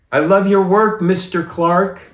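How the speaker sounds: called out high and loud; an usher's eager call across the hall; calling out from afar. Retold or imitated. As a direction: shout it up to the back rows. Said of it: "we hear this voice go".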